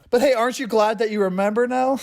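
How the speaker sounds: high, gleeful fake enthusiasm; mock cheerful taunt. Friends joke about handing back horrible memories, mock cheerful. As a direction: chirp it with sarcastic delight.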